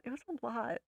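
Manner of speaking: quiet, trailing emphasis; hushed repeat. Real, not acted. Repeats herself, second time hushed.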